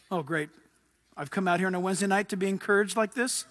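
a churchgoer grumbling at the grim Wednesday-night message, deadpan; mock sarcasm. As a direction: play the let-down listener, dry.

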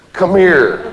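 mock beckoning, lightly voiced. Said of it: "I love that move".

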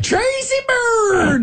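high, long-drawn holler; mock shout. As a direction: holler the name out in an exaggerated high voice. Mock answer to a scam caller.

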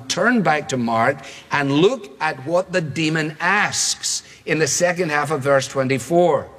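ordinary talk

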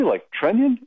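Trenyon? rising high in disbelief; high questioning Trenyon; surprised question. Retold or imitated. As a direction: ask it back, surprised and doubtful.

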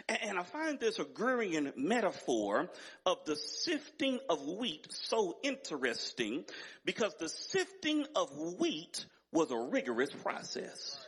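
normal talking voice

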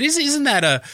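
reliving a puzzled question; surprised query. Retold or imitated. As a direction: ask it in high-pitched disbelief. Recalls own surprised question on air.

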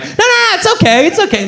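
very high panicked pleading; begging Maria to stay on the call. Retold or imitated. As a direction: squeal it high to stop her. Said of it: "And I'm like".

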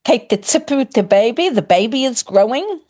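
ordinary talk, nothing marked

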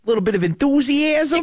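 high, squeaky mock of the candidate's accent; mocking Minnesota twang. Host mocking the senate candidate's accent, high and squeaky. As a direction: parrot her phrase in a high mocking twang. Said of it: "This is very Minnesota-y".

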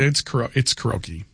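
karaoke as a short "kuh-ROH-key"; stumble, then insist on karaoke as "kuh-roh-key"; Japanese-style karaoke. Real, not acted. Host defending his karaoke, said "kuh-roh-key". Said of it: "I'm pronouncing it karaoke".

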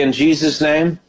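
ordinary delivery

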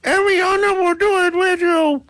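piercingly high ventriloquist dummy voice; squeaky puppeteer act; thrown puppet voice. Acted. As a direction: speak for her in a tiny high puppet voice. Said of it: "trying to throw my voice like that puppeteer dude".

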